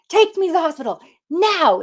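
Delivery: high, loud insistent plea; urgent demand. Retold or imitated. To the father of her kid, loud urgent demand.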